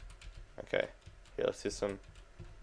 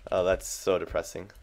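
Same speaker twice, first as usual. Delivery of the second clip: normal talking voice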